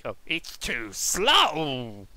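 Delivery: slow, low grumpy sulk; grumpy mutter. Acted. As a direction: sulk under the breath. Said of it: "all grumpy like".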